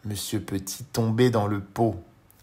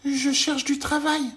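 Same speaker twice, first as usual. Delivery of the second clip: very high, louder little voice; tiny Monsieur Petit; 5 dB louder than usual. Acted. Tiny man greets the worm, very high voice.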